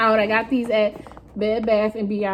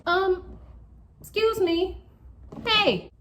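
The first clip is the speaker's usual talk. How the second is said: high-pitched voice; 10 semitones higher, 4 dB quieter, slower than usual. Real, not acted. Talking to pet, high voice.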